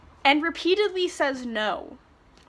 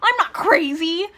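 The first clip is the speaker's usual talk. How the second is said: high, loud mock protest; mock-frantic denial; 6 semitones higher, 7 dB louder than usual. Reader mocks a character holding a knife, hammed-up frantic denial. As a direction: act out an over-the-top indignant denial.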